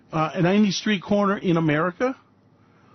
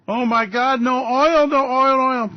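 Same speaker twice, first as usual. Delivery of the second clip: louder, higher mock alarm; mock panic; 6 semitones higher, 5 dB louder, slower than usual. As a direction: parody the doom-sayers crying shortage. Mocking the oil-shortage alarmists.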